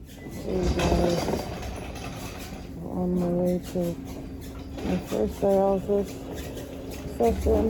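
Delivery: muffled behind an oxygen mask; talking through a face mask. Real, not acted. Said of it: "Very hard to talk with this mask on".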